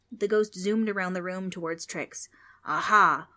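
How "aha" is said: triumphant aha. Acted. Ghost triumphant.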